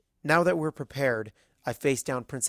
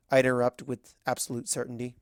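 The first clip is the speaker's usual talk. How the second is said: usual speaking voice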